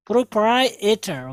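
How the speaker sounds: प्रोप्राइटर slowly, as "pro-prai-e-ter"; correct pronunciation. Shown as a demonstration. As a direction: separate an "e" inside प्रोप्राइटर. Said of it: "ई की भी आवाज़ निकालेंगे". (प्रोप्राइटर को धीरे से "प्रो-प्राइ-ए-टर")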